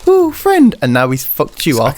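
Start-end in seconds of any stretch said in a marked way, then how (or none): 0.1-0.8 high, loud sugary greeting; gushing high voice. Retold or imitated. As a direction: gush over a friend, pitch way up. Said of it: "One week he was absolutely like".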